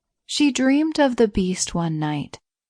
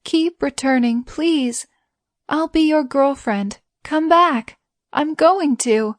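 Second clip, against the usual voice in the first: high, loud tearful begging; crying plea; 7 semitones higher, 4 dB louder than usual. A narrator's reading. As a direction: beg through tears. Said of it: "She's been crying".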